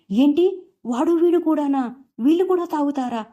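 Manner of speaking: high, stunned disbelief; mother stunned her sons drink; shocked surprise. A narrator's reading. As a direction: gasp it out, pitched high in shock. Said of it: "అంటూ ఆశ్చర్యపోతుంది".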